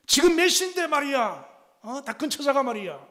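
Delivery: loud, high scolding of a late daughter; yelling father. Retold or imitated. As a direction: bark at her like an angry dad. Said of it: "막 큰 소리로 뭐라 하죠". (큰 소리로 야단치는 아빠)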